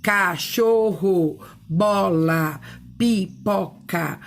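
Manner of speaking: "cachorro, bola, pipoca" broken slowly syllable by syllable; split into syllables. Shown as a demonstration. Teacher showing how words divide, "cachorro, bola, pipoca" in slow separated syllables. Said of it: "essa segmentação".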